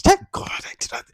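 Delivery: relieved whisper to herself. Pretend. Imagined actor on set whispers in relief. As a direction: breathe it out under your breath.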